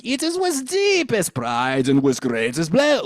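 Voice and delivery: high, grand French announcement; big grand French accent. Shown as a demonstration. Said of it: "Make it really big".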